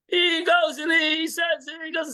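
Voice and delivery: high, slow, loud declaration; slow, rising-pitch shout; emphatic shout. Real, not acted. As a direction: boom it out like a preacher. Emphatic story of the lion.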